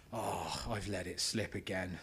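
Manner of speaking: low, quiet mock dismay; dismayed groan to self. Speaker to himself after his accent shows, dismayed. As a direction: sink into it, low and resigned.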